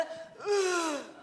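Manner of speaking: ein zögerndes, gedehntes „Äh“. Shown as a demonstration. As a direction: das Äh lang und zögerlich ziehen. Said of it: "sondern alle". (gedehntes, zögerndes „Äh“)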